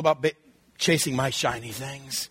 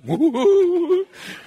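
bursts into a high laugh; real high laugh; 13 semitones higher, 4 dB louder than usual. Real, not acted. Preacher laughing at his own joke, high laugh.

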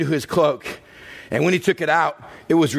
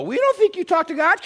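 mocking skeptics. Skeptics voiced, high.

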